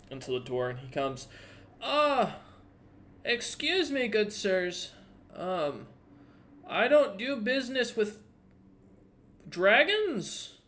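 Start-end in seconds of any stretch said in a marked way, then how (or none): 1.8-10.6 put-on high, reedy old man voice, wary and fussy; old blind shopkeeper. Blind shopkeeper to party, high wary old voice. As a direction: play the blind old merchant sniffing out trouble.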